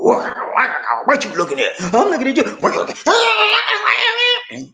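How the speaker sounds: hammed-up squabble rising to a screech; cats-and-dogs quarrel. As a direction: act a bickering couple, ending in high squeals. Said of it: "you like cats and dogs".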